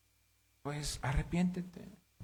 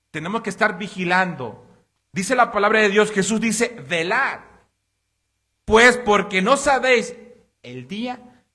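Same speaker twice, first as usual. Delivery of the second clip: fuerte y alto, exhortando a la congregación; predicación a toda voz; 9 semitones higher, 17 dB louder than usual. Acted. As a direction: clama como pastor desde el púlpito. Predica con fuerza. (el pastor exhorta a la congregación, predicando a toda voz)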